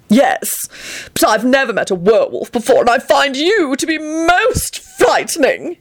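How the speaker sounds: high, affected dread; haughty, shivering fear. A narrator's reading. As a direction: draw out the horror, pitch climbing. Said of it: "he shivered".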